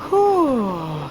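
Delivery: long falling "whew"; weary sigh. A narrator's reading. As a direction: let out a tired, relieved sigh.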